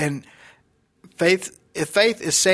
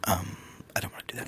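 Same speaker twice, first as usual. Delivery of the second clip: whisper; 9 semitones lower, 12 dB quieter, faster than usual. Pretend. Mock whine aside.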